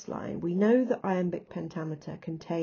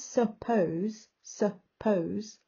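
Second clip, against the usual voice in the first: "suppose" slowly split, "sup" then stretched "pose"; syllable stress; 3 semitones higher, much slower than usual. Shown as a demonstration. Teacher proving a word is an iamb, syllable stress. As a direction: break "suppose" in two, leaning on "pose". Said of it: "It's got unstressed, stressed".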